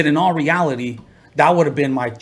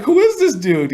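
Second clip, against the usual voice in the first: high, loud astonished question; amazed; 12 semitones higher, 5 dB louder than usual. Retold or imitated. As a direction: marvel out loud, higher and louder. Repeating attorney's amazement.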